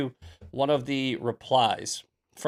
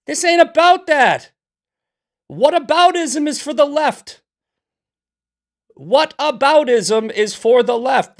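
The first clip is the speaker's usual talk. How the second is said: much higher and louder, yelling at chat; furious rant; 8 semitones higher, 5 dB louder than usual. Real, not acted. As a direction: blow up at the chat, shouting.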